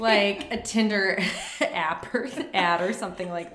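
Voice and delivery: slow, laughing through words. Real, not acted. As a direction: laugh while saying it. Laughing at the joke.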